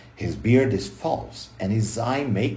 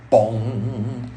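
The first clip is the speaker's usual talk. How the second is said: a springy, ringing bong, rising at the end; playful vocal boing; mock bong sound effect; 2 semitones higher than usual. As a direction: sound out the clown bouncing back up. Sound effect of clown bouncing.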